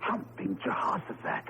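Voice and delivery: breathless, whispered shock; hushed, frightened whisper; stunned whisper. Acted. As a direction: gasp it out at the sight of the statue. Sees the statue in doorway.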